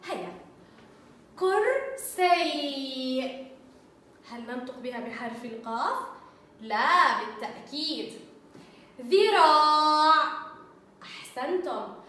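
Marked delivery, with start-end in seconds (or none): 1.4-3.2 كرسي stretched out very slowly, each sound held. Shown as a demonstration. Teacher sounding out a picture word for pupils, very slow. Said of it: "أنطق بها بصوت القاف". 9.0-10.5 ذراع drawn out very slowly and louder; slow sounding out. Shown as a demonstration. Teacher sounding out a picture word for pupils, very slow and loud. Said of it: "أنطق بها بصوت القاف".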